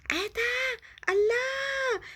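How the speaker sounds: wide-eyed delighted shout; amazed shout. Acted. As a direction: gasp at the sight of the sea. Child amazed seeing the sea.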